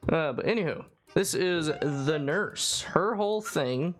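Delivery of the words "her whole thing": ordinary talk, nothing marked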